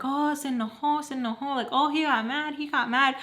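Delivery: teasing taunt. Retold or imitated. Friends taunting the ex.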